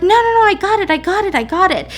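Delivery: high, hammed-up flustered insistence; flustered refusal. Self-conscious tenant refusing doorman's help, flustered. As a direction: wave off help in a high rush.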